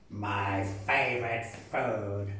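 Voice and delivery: low, deep monster voice; hungry Gruffalo. Acted. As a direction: rumble it like a big beast.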